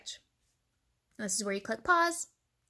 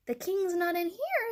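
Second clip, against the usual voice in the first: Foxy Loxy; 9 semitones higher than usual. A narrator's reading. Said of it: "said Foxy Loxy".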